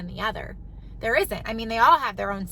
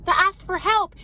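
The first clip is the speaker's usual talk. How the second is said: usual speaking voice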